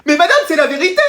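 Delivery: high, whining pupil; pleading protest. Acted. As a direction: insist to the teacher you are honest, high. Pupil protesting to disbelieving teacher. (élève qui proteste, voix aiguë)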